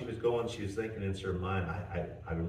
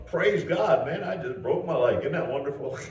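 higher, louder hammed-up joy; mock ecstatic praise; 5 semitones higher, 6 dB louder than usual. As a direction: rejoice over the broken leg, overdone. Mock joy over broken leg.

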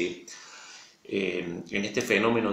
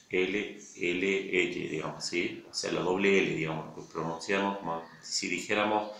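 ordinary delivery

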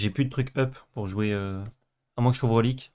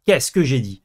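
louder gloating qu'est-ce que j'ai dit; loud triumphant repeat; 7 dB louder than usual. Real, not acted. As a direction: crow it out, told you so. (répété fort, triomphant)

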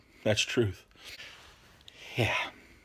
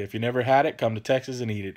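ordinary talk

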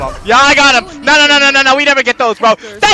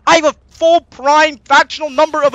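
raised, loud insistence; indignant protest; 3 semitones higher, 4 dB louder than usual. Real, not acted. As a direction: insist loudly on your score. Repeats protest in game.

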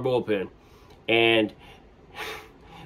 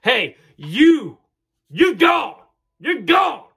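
high, loud dismissal; angry ejection shout; 7 dB louder, much slower than usual. Retold or imitated. Coach ejecting the pitcher, loud high shout. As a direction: throw him out with a yell. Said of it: "our coach turns around and says".

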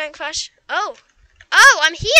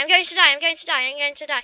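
ordinary talk

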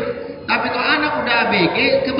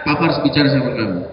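stern father; 8 semitones lower, faster than usual. Retold or imitated.

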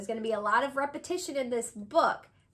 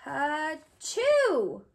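big playful fake achoo; hammed-up sneeze; mock sneeze; 5 semitones higher, 7 dB louder than usual. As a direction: act out a loud sneeze for the kids. Acting out the old lady's sneeze.

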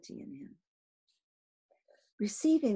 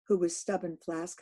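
usual speaking voice

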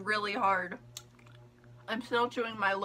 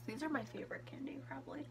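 whispered aside; whispering; 5 semitones higher, 12 dB quieter, faster than usual. Real, not acted. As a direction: breathe it like a secret. Quiet confiding aside.

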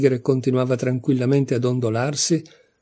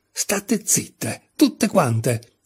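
mildly furious narrated shout, pitched up; angry cry; 3 semitones higher, much slower than usual. A narrator's reading. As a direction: silence a noisy crowd sharply. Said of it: "gridò il giglio tigre".

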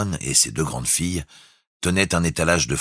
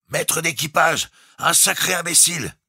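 shivering yell. A narrator's reading. Jukes, freezing, yells back at the captain. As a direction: yell it through chattering teeth. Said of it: "hurla Jukes de sa voix grelottante".

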